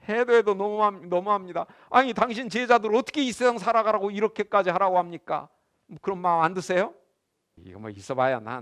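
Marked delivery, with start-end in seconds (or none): none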